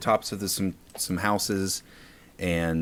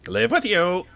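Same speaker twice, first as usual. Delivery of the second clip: much higher, stunned echo; astonished creature; 11 semitones higher than usual. Acted. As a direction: echo it in disbelief. Creature repeats offer, astonished.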